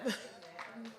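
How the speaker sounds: high airy laugh through tears; tearful laugh. Real, not acted. Speaker thanking the representative, tearful laugh. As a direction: laugh helplessly while welling up. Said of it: "you can feel the spirit and the love".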